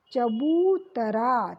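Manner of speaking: चबूतरा said slowly, the ऊ stretched; sounding out the word. Shown as a demonstration. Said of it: "क्या होता है?". (चबूतरा — धीरे, ऊ लंबा)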